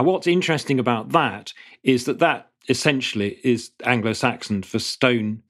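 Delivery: ordinary talk, nothing marked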